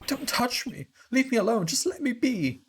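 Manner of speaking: very high, hammed-up outburst; distraught protest. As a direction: fend them off in a high, upset cry. Pushing others away in grief.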